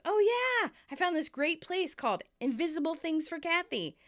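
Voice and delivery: acted sarcastic rant, climbing at the end. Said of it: "Chandler sarcastically says".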